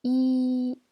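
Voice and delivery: "I" held high and level; first tone. Shown as a demonstration. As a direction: sustain "I" on one high, loud note. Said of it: "je répète les quatre tons".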